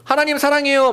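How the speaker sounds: eager vow. Retold or imitated. Churchgoer vowing love to God in worship, gushing. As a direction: gush it like a fervent worshipper.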